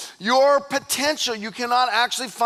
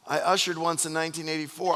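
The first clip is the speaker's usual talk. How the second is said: mock sheepish answer; 7 semitones lower, 6 dB quieter than usual. Acts clueless churchgoer's feeble answer.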